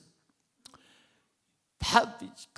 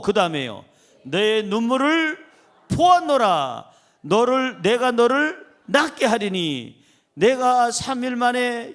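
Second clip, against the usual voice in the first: preaching scripture; 5 dB louder than usual. Acted. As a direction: proclaim each word like a prophet. Reads God's words in preaching. (힘있게 선포하는 설교)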